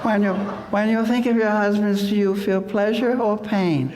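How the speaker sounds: high, cloying mock interviewer, laughing. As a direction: parody a TV host fishing for tears. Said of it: "she says".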